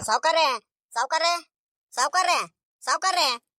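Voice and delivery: repeated hailing call, pitch lifted. Acted. Villager looking for the absent boss, calling out. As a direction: call out for someone who is missing. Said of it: "ಹಿಂಗ್ ಕೂಕಣ್ತಿದೀಯೋ".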